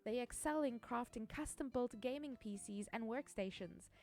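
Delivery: soft, even sponsor read. Acted. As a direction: read it like a polished ad. Reads a sponsor ad.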